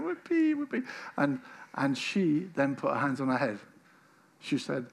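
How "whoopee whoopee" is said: cheering friends. Retold or imitated. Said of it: "friends were standing around going".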